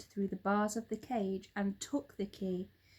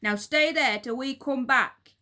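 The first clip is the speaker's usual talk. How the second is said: louder, stern command; stern order; 4 semitones higher, 10 dB louder than usual. A narrator's reading. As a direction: lay down the law to the monkeys. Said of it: "Mister Twit ordered".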